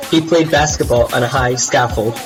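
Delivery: ordinary delivery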